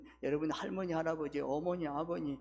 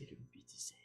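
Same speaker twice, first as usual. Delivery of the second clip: whispered urging; 17 dB quieter, faster than usual. Real, not acted.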